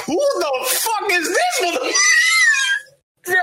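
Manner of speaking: very high, screechy reenacted yell; shocked outrage. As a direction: replay freaking out at an intruder, voice shooting up. Reenacts finding masked man in bed.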